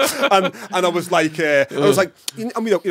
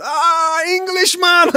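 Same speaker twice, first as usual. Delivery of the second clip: high, loud jeering shout of Englishman; a local man jeers at the father, loud and high; mocking shout; 14 semitones higher, 8 dB louder, much slower than usual. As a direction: yell it out like a street hustler taunting him. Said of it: "the guy's going".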